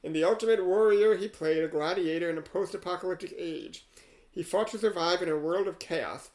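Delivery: rhythmic verse reading, lines leaning hard on their openings; poem recitation. Acted. Host reading an AI poem about Yul Brynner, measured recitation. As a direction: recite a rhyming tribute line by line. Reads the rhyming poem aloud.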